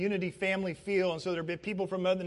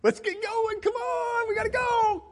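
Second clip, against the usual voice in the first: high, sustained holler; high-pitched sing-out shout; calling out. Acted. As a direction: yell to hurry someone out to the car. Calling someone out to leave.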